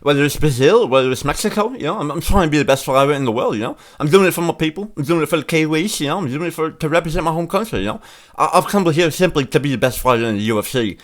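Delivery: a hint of a thick-mouthed lispy voice; lightly voiced fighter with gunk in the mouth; Steve Erceg impression. YouTuber doing Erceg, lightly thick-mouthed speech. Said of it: "another flyweight we got here, Steve Erceg".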